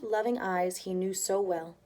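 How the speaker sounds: ordinary delivery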